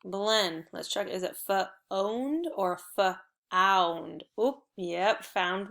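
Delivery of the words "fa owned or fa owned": "fa-owned" drawn out, first with OH, then with OW; sounding out both ways. Shown as a demonstration. Teacher checking which vowel fits, "fa-owned" slowly sounded out. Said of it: "we have to try both ways".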